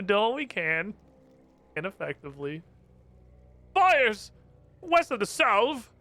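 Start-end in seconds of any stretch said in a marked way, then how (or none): 3.7-5.9 high-pitched dismayed exclamations; theatrical panic in short, high bursts; acted alarm. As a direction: cry out as if disaster has struck. Game character reports enemy attack.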